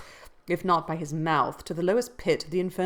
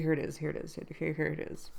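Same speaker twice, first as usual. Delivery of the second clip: usual speaking voice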